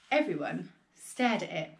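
ordinary delivery

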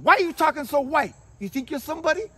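high, loud mock accusation; jeering accuser. Putting down someone climbing up, high mocking challenge. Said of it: "somebody goes".

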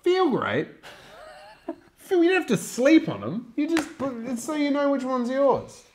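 high-pitched laughing disbelief; amused incredulity. Real, not acted. Challenging a friend's complaint about rubber rings, high incredulous protest.

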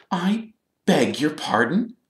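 high, slow affronted reply; stiff. A narrator's reading. Girl affronted by a stranger's question, stiff. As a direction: draw it out with offended formality. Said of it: "said the girl stiffly".

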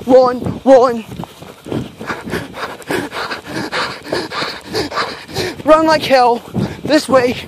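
breathless fleeing panic; panicked running. Real, not acted. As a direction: yell at yourself to run for it.

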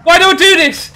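loud frustrated shout; raging gamer. Real, not acted. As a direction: yell at yourself in despair. Frustrated after crashing.

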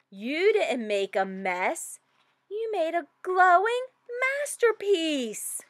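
put-on gentle mom praising her child, rising high at the end; a hint of a proud mom; lightly voiced mom. A narrator's reading. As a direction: reassure like a proud mother.

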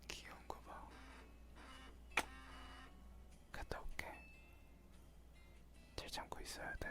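teasing giggly whisper. Acted. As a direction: whisper it softly and tease. Teasing whisper before leaving.

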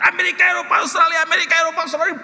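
loud, high screamed list; frantic yelling. Retold or imitated. Preacher re-enacting his shouting in the car, frantic yell. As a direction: scream the place names in a fury. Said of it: "Jadi pada lagi teriak-teriak".

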